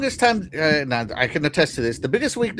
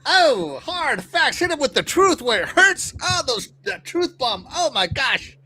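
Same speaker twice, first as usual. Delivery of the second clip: higher pitched hyped reaction; excited; 9 semitones higher, slower than usual. Real, not acted. Host reacting to a hard-hitting read, excited. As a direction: gush over the truth bomb.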